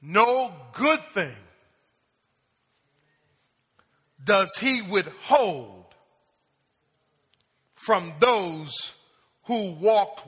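slow, weighty reading of the verse; slow emphatic reading. Shown as a demonstration. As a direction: lay each word down slowly for the church. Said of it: "Now, watch this".

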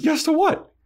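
very high, baffled question; mock confused boyfriend, way up high; high mock Angel. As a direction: squeak it in confusion. Angel confused in retold quarrel.

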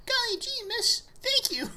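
put-on high, gee-whiz kid voice; golly-gee delivery boy; wholesome 1950s kid. Delivery kid thanks for tip.

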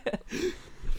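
high-pitched burst of laughter; real laugh, pitched well up. Real, not acted. Laughing at bad goaltending.

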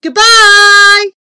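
high, long, loud call; shouted farewell. A narrator's reading. Departing visitor calls to the busy giant, shouting. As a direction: holler it up to a giant. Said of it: "I shouted".

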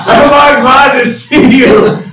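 high, loud, slow relieved yell; relieved shout. Retold or imitated. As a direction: bellow your relief. Friend relieved to see him.

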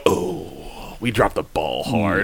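slow, low, hushed admission; mock dismayed realisation. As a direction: let it sink in slowly and softly. Acting out readers' dismayed realisation.

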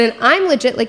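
usual speaking voice

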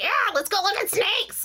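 high-pitched manic cheer; crazy sugar high. Retold or imitated. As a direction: act out frothing over-excitement. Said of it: "Like, I'm just crazy".

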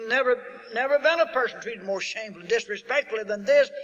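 fervent sermon climax; full-force preaching. Acted. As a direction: hammer each question home from the pulpit.

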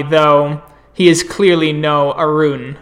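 ordinary delivery

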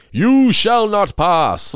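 very slow, loud, high booming decree; wizard guard. Retold or imitated. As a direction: block the door like a wizard. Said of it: "I'm like".

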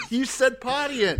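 high, slow, laughing squeal; cracking up. Real, not acted. Cracking up at co-host's word.

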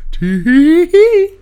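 high, slow sing-song tee-hee; high giggly tee-hee. Pretend. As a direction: draw out a girly giggle. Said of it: "new catchphrase laugh".

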